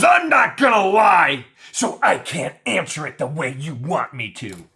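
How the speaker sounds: high, loud defiant whine; defiant mock shout. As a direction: play her as stubborn. Mocking her defiant reply.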